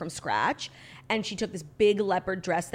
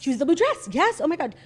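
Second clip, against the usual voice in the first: excited; 9 semitones higher, 6 dB louder than usual. Real, not acted. Excited reaction to blue dress.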